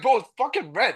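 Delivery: high-pitched retold warning; alarmed protest. Passenger warning his friend about the red light, alarmed.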